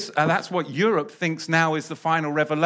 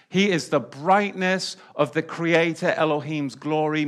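ordinary delivery

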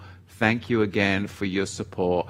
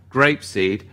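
normal talking voice

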